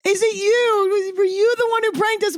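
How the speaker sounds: high panicked yell at the ghost; screaming at the ghost. Retold or imitated. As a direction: cry it up high to an unseen ghost. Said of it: "we start screaming at the ghost".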